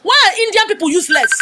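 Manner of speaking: loud, high exasperated demand; exasperated rant. Real, not acted. Vlogger ranting about insulting comments, loud exasperation. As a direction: throw the question up in outrage.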